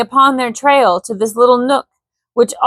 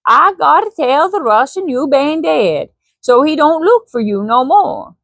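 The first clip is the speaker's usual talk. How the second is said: grinning Swede, higher; Swedish accent; 6 semitones higher than usual. Acted. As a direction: Swedish voice with a grin. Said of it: "didn't do his accent".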